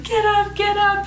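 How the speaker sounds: high, urgent hurry-up voice; urging crowd. Retold or imitated. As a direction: urge her up from the crowd. Everyone urging her.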